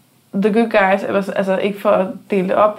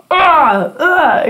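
a hammed-up loud grunt, higher and louder; mock shout; 7 semitones higher, 5 dB louder than usual. Mocking her own yelling at training, loud mock shout. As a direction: belt out a silly workout yell. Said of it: "man står der og råber".

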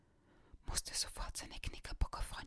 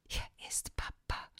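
chuckling; 6 dB louder than usual. Acted. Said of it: "kam es glucksend aus dem Hörer".

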